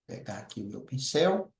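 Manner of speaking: normal talking voice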